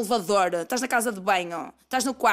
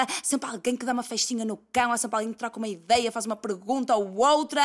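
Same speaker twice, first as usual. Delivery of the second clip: ordinary talk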